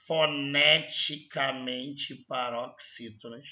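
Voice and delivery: "foneticamente" very slow, syllable by syllable; deliberate emphasis. Real, not acted. Teacher reading the key clause to students, deliberate emphasis. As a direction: spell out "foneticamente paroxítonas" at a slow, measured pace. Stresses the disputed phrase of document.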